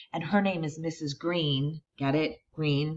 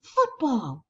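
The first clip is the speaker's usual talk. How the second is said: shouting; 8 semitones higher than usual. Acted. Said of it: "he shouts".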